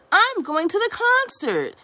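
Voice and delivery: high, loud defiant yell. Acted. May yelling at mother while running out, loud. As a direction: shout it as you run off. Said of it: "she yelled".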